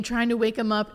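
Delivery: ordinary talk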